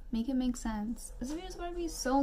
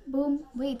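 ordinary talk, nothing marked

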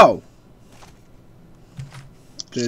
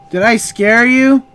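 teasing; 6 semitones higher, 7 dB louder, slower than usual. Pretend. Teasing after the boo.

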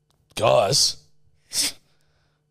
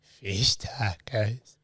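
soft and slow, suggestive; quiet slow voice; 5 semitones lower, 12 dB quieter, slower than usual. Acted. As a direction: murmur it suggestively.

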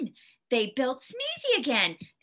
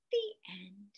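whispered; 5 semitones lower, 10 dB quieter than usual. Acted. Closing the story.

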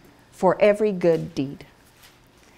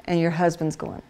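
ordinary delivery

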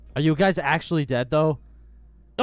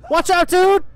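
high, loud warning yell; alarmed shout; 12 dB louder than usual. Real, not acted. Player warning of danger, alarmed shout.

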